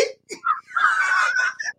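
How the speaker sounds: high squealing burst of laughter; shrieking laugh. Real, not acted. Streamer spots skunk on his camera, high shrieking laugh.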